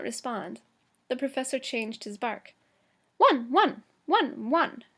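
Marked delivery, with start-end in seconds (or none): 3.2-4.8 growling dog. Acted. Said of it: "in the deep growling voice of a large, angry dog".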